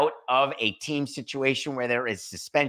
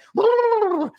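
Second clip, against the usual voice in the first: a gleeful squeal far up high; high squealing laugh; 8 dB louder than usual. Acted. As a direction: squeal with delight like a giddy kid. Snoopy dance squeal.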